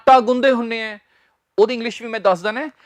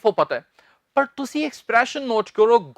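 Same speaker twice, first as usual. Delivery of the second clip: ordinary talk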